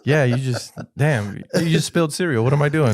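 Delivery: high, loud self-reproach; retold flustered outburst; flustered dad. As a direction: sputter in mock exasperation at yourself. Flustered father retold, belt in hand.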